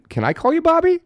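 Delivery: timid ask. Retold or imitated. Asking De Niro a nervous favour.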